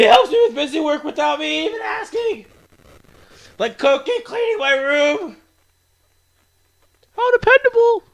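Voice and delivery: put-on high, girlish voice vouching for a friend; high-pitched friendly character; cheery club girl. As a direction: pitch it way up like an upbeat anime girl.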